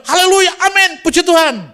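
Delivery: very high, loud pulpit cry; imitating a fired-up preacher's hallelujah; fiery praise shout. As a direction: whip up the congregation with a praise shout. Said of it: "Berapi-api saya ngomong".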